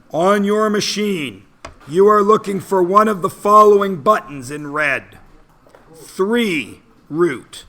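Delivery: high and loud, like a presenter; loud announcer voice. Acted. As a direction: announce it to the room, big and bright.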